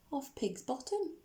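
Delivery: ordinary delivery, nothing marked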